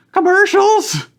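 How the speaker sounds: very high, loud squeak; squeaky voice. Acted. Tyler questioning Damien in disbelief, squeaky voice. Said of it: "that's my squeaky voice guy".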